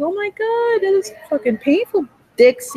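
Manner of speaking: high, pained outcry, a touch hammed-up; mock pained cry. Imagining the abused baby's pain, a high mock outcry. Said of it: "I'm like".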